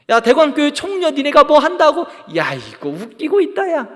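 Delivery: loud taunt that drops into dismissal; scornful jeer. Acted. Preacher acting scoffers belittling his own church, scornful. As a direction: laugh them off with contempt. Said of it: "그렇게 비웃는 거예요".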